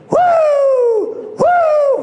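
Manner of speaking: loud, high whoops; imitated woo cries. Imitating the old man whooping while he preached. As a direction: whoop right in the preacher's ear. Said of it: "And he said".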